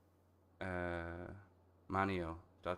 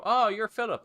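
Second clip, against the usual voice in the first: higher, louder formal greeting; mock professional voice; 12 semitones higher, 10 dB louder than usual. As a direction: play a stiff office worker. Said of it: "they go".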